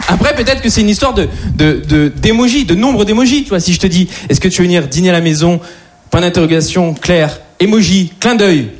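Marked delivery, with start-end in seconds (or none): none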